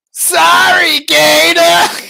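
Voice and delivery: loud, high shout of sorry; belting out a sorry to Gator; shouted apology. Acted. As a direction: yell the apology at the top of your voice.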